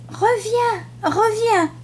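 calling out. A narrator's reading. Lamb calling after the departing butterfly, high pleading call. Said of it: "il cria".

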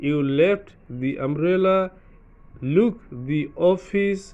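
each "the" as "dee", drawn out very slowly; very slow demo. Shown as a demonstration. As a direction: spell it out word by word for learners. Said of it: "slow recap of examples".

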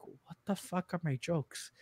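panicked inner thought. Retold or imitated. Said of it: "And I'm like".

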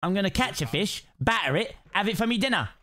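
light mock Cockney accent; put-on Cockney. Sheep player fishing for a bite, playful Cockney accent. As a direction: play a Cockney angler coaxing a bite. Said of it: "I've become Cockney".

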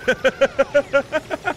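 high, rising stuttered laugh; giddy villain giggle; long high cackle; mischievous cackle. Acted. Giddy about opening the airlock.

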